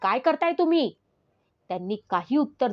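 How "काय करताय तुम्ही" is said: high-pitched shouted challenge; raised, higher-pitched demand; teacher's shout. Acted. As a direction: call out in alarm like a teacher. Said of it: "शिक्षक ओरडले".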